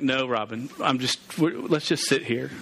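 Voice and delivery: low, reluctant superhero; Batman. Acted. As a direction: drop low and beg off the rescue. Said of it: "Batman would be like".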